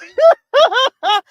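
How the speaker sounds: loud high burst of laughter. Real, not acted. Laughing at her old video, high and loud. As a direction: crack up at herself.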